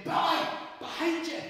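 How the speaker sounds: high, loud call from a distance; big ringing shout. A narrator's reading. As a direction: shout the warning, high and urgent. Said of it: "and then the voice again".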